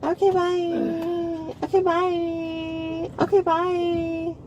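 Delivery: mocking goodbye. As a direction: stretch it out to taunt and drown him out. Mimicking officer's goodbye to shut him out.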